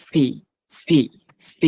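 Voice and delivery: "ফি" with a burst of breath; aspirated p in each "ফি"; airy P. Shown as a demonstration. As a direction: drill "ফি" with air after the lips open. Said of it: "বাতাস আবার বের হবে".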